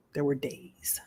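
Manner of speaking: hushed but voiced; soft spoken. Real, not acted. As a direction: say it softly, barely aloud.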